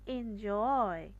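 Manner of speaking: Enjoy slow, with JOY drawn out; light EN, long stressed JOY in enjoy; stress on second syllable. Shown as a demonstration. Teacher introducing the next example, second syllable stressed. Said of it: "জয়টাকে খুব চাপ দিয়ে".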